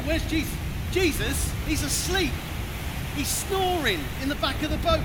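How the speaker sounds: loud, high, alarmed calling; frantic shout. Acted. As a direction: shout for him in panic. Disciples searching for Jesus.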